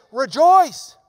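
loud, high exclamation of Rejoice; a ringing Rejoice; Paul's call. Acted. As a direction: proclaim it like Paul. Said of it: "He's saying".

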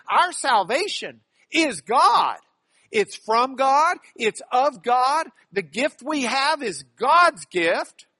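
high, loud phrases each landing on "God"; emphatic on GOD. Shown as a demonstration. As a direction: preach it, hammering "God" every time. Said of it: "Paul's emphasis in this passage".

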